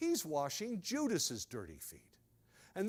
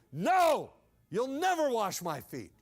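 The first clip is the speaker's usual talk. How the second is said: loud, higher cry of horror; horrified refusal; 4 semitones higher, 8 dB louder than usual. Acted. Peter refusing to let Jesus wash his feet, loud and horrified. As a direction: recoil in shock. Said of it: "You can see the horror in it".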